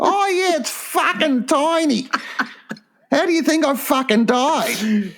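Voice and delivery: very high, drawn-out mock wife; high, slow comic put-down; old wife's voice. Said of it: "What would my old wife say".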